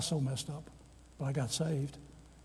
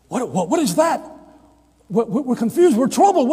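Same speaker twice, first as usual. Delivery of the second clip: loud, high, alarmed cries; confused disciples; 9 semitones higher, 13 dB louder than usual. Acted.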